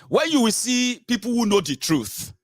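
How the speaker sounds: ordinary talk, nothing marked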